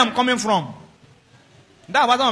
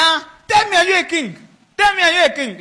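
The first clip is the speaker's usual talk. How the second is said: high, loud, insistent questioning; shouted demand; 10 semitones higher, 7 dB louder than usual. Acted. Accuser demanding whether Jesus is a king, shouting. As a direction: hammer the question as an interrogator.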